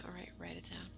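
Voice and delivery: a low, breathy whisper under the breath. Acted. Repeating the order to write it down, whispered. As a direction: whisper it like a private aside.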